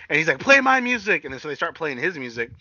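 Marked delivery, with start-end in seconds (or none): 0.4-1.2 mock wrestler demanding, pitched up; higher-pitched retold command; imitated demand. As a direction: call it out high like a cocky wrestler.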